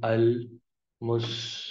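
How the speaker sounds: المسلمات drawn out slowly; slow teaching pronunciation; slow model word. Shown as a demonstration. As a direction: stretch المسلمات. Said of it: "جمع کے لیے کہیں گے".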